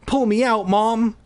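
high-pitched begging to mom; urgent plea. A narrator's reading. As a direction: urge her to rescue you. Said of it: "I urged silently".

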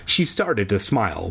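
ordinary delivery

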